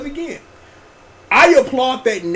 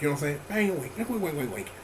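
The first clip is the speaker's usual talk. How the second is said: ordinary talk, nothing marked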